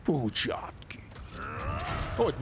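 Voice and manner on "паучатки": usual speaking voice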